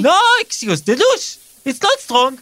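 high-pitched complaint in broken English; aggrieved Hebrew-accented holidaymaker; indignant tourist. Acted. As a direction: whine about the service as a fussy guest. Guest complains about weak shower.

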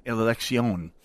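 elección rolled out slowly in a hammed-up Spanish accent; mock Spanish accent. Host mocking public radio pronunciation, slow hammed-up Spanish accent. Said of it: "pronounce all Central American names as if I am Ricardo Montalban".

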